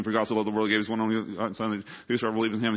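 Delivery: put-on child racing through the verse; rattled off fast. Child reciting John 3:16 for the teacher, rushed. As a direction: gabble the memory verse at top speed. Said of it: "but he says it super fast".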